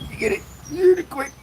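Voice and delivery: teasing in a high put-on voice; playful put-on voice. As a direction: squeak it out like a teasing character. Acted playful voice.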